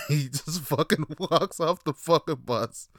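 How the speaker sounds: high, laughing retelling; real laughter. Real, not acted. Storyteller amazed the dealer walked off, talking through real laughter. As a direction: say it while cracking up. Cracking up at his own story.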